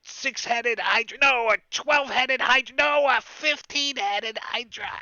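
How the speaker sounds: a sheep bragging bigger and bigger; boastful sheep wizard. Acted. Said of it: "And he says".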